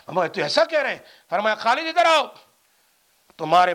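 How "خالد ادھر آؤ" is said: raised, higher summoning voice; calling someone over. Retold or imitated. Preacher voicing the summons to Khalid, called out. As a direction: call him over loudly, as in a retold story.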